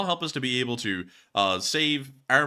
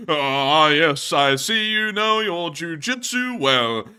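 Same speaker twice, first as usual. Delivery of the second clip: loud, slow theatrical approval; hammed-up arrestee conceding a point; grand mock admiration; 2 semitones higher, 6 dB louder, much slower than usual. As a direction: savour it like a pompous orator. Acting out a famous arrest rant.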